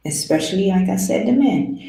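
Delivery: normal talking voice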